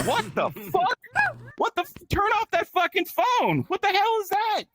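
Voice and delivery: high-pitched mock outrage; appalled father. Father hearing his daughter's SpongeBob call, appalled. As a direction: squeal it in scandalized disbelief.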